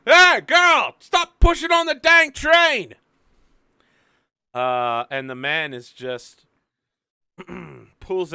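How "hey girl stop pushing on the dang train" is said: high, loud yell from far back; conductor yelling. Acted. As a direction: holler it like an angry old conductor. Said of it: "some train conductor at the back".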